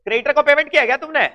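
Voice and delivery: teacher pushing students for the entry; pressing question. Real, not acted. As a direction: fire the question at the class. Teacher pressing class for answer.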